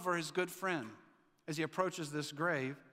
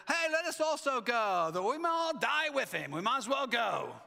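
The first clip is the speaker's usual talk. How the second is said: high, louder mock-fatalistic whine; mock doom and resignation; 5 semitones higher, 3 dB louder than usual. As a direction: play up the hopeless resignation. Acting a gloomy disciple's resigned line.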